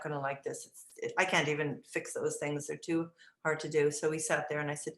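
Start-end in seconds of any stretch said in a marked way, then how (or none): none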